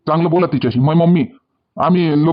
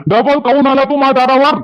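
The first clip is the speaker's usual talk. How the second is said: angry shout; 6 semitones higher than usual. Acted. Father chases away unwanted suitor.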